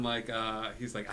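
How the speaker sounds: normal talking voice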